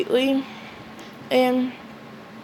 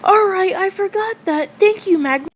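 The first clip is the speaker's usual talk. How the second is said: put-on high, louder boyish voice; Asta voice; 9 semitones higher, 3 dB louder than usual. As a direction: raise it up into the eager kid. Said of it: "And Asta says".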